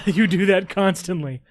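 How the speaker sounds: loud laugh running into the words. Real, not acted. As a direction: tease through a burst of laughter. Real laughter while teasing a friend.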